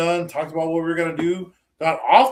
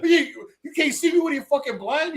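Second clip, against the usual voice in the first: high put-on voice, laughing; hammed-up high-pitched taunt; mock outraged yell; 10 semitones higher than usual. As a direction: squeak it out, incredulous. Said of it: "continuing the movie quote".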